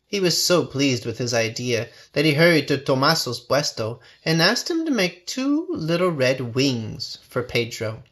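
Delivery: ordinary delivery, nothing marked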